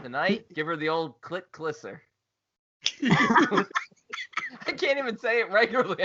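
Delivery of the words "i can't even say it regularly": laughing. Real, not acted. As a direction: laugh through the words. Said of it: "laughing at himself".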